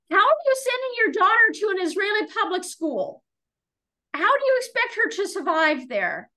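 high-pitched indignant demand, retold; scolding counselor. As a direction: imitate an outraged counselor taking a mother to task. Retold counselor scolding her by phone.

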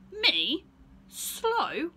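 high, loud offended squeak; outraged disbelief; indignant bear. Acted. Bear offended by Stephen's taunt, loud and indignant.

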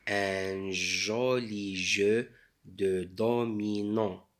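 ordinary talk, nothing marked

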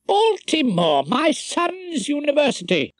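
jumps high in pleased surprise; a sudden happy recognition, pitched high; delighted surprise. A narrator's reading. Rumpole delighted by the card.

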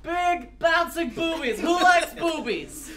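ordinary talk, nothing marked

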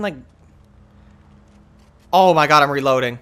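normal talking voice

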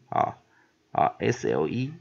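normal talking voice

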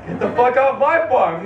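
indignant retort. Acted. Acted quarrel, farmer answers llama.

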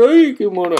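quick, high, playful gush over the little light; cutesy mock gush. Showing viewers the reader light, mock cutesy coo. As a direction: coo at the blinking light like it's adorable. Mock cute reaction of device.

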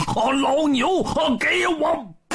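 high, drawn-out attacking yell; copycat battle cry. A narrator's reading. Zhu Bajie copies Monkey's attack, high battle cry. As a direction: yell it high, stretching the last word. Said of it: "也学着孙悟空的样子大喊一声".

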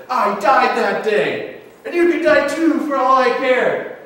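slow, high, loud declaration; acted princess outrage. Princess to her lover's killer, acted outrage. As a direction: hurl it out like a wounded princess. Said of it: "and she says".

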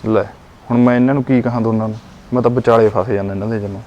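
muttered aside. Acted. Son stuck between mother and wife, muttering low. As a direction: mutter it under your breath, fast.

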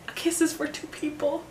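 high, softly voiced coaching line; Colin. Retelling a man coaching a woman through a kiss, high soft imitation. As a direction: lightly imitate a gentle romantic coach. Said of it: "Tell me that isn't Coach Colin".

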